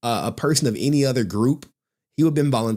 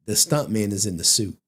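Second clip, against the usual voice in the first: normal talking voice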